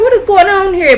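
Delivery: high-pitched incredulous question; hammed-up confused reaction; baffled disbelief. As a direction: ask it in exaggerated bewilderment. Her baffled reaction to the scene.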